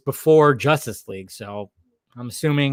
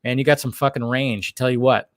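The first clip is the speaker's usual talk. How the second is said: usual speaking voice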